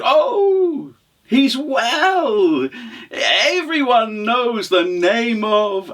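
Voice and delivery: high, drawn-out effusive voice; bluffing traveller. Acted. Traveller not knowing husband's name, effusive stalling. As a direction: draw it out, fishing for the name.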